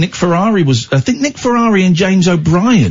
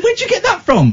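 surprised. Retold or imitated. Retold question to son.

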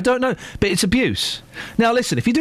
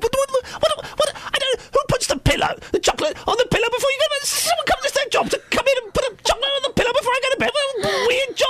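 very high, frantic comedian's rant; Michael McIntyre; faster than usual. Acted. Presenter mocking a comedian's routine, high gabbling impression. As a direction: gabble it in a squeaky, overexcited stand-up voice. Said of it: "People can laugh at a Michael McIntyre show".

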